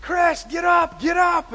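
very high, loud yell to wake him; frantic counselor yelling; urgent shout. Retold or imitated. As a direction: shout a friend awake in a storm. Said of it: "yelling at me".

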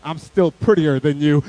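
low, slow, loud jab; sore-loser brother. Retold or imitated. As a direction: say it slowly as a petty comeback.

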